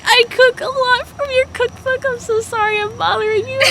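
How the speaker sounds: high, nervous fan gushing at a celebrity; starstruck gushing. Retold or imitated. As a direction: gush up high like a flustered fan. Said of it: "and I was like".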